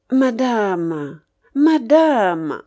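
drawn-out, cajoling appeal; lightly sugary, coaxing address; honeyed voice. A narrator's reading. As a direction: coax her with mild syrupy politeness. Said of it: "dit d'une voix doucereuse De Grier".

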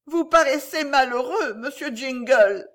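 voix de dame plaintive, haut perchée; high, plaintive lady's lament; plaintif. A narrator's reading. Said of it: "dit la dame d'une voix plaintive". (voix plaintive et aiguë)

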